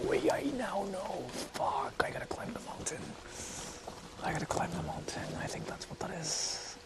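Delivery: real whisper while sneaking around. Real, not acted. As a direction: whisper so no one nearby hears. Sneaking near someone's property.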